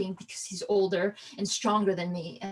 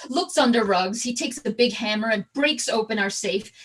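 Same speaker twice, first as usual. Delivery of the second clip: usual speaking voice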